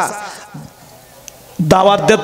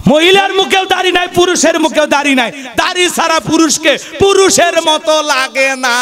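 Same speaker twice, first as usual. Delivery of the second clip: ringing high sermon emphasis; fervent preaching; 9 semitones higher than usual. Real, not acted. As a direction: rise to a peak of fervour. Preacher makes emphatic point.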